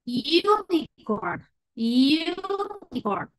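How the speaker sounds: unicorn said very slowly, the long u drawn out; long vowel demo. Shown as a demonstration. Teacher modelling unicorn slowly for the class. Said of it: "Palabra con sonido largo".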